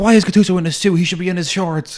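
put-on high, loud grumbling old fan; playfully imitating an outraged supporter; hammed-up stadium grumble; mock fan complaint. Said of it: "They're like".

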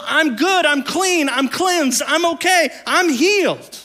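high joyful exclaiming, lightly acted; joyful cry. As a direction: call out the good news like a healed man. Healed lepers shouting joy.

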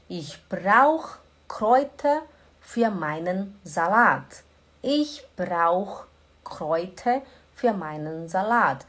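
slow, clear Ich brauch Kräuter für meinen Salat; slow model sentence. Shown as a demonstration. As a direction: drill it slowly for learners. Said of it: "slow model sentence for class".

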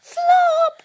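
put-on very high, loud child's call; excited cry. A narrator's reading. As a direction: cry out a name in amazement. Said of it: "cries Bing".

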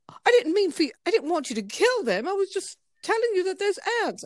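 high, plaintive mock British protest; horrified British accent. Client protesting the ant massacre to masseuse, horrified British accent. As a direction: imitate an upset Brit, voice pitched well up. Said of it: "He was horrified".

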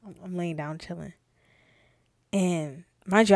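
ordinary delivery

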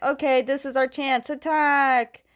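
rally the troops, half-shouting, stretching the last word; mock battle cry. Army seizing its chance against the tanks, mock battle cry. Retold army calling the charge.

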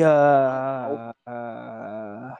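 muffled behind a hand; hand over mouth. Real, not acted. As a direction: talk through your cupped hand. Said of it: "with your hand over your mouth".

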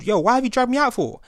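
indignant shout. Retold or imitated. Retold quarrel with bouncers.

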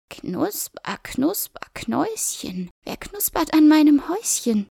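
sing-song witch calling out. A narrator's reading. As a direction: lure them with a crooning call. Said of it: "hörten sie dann aus dem Haus".

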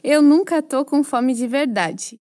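usual speaking voice

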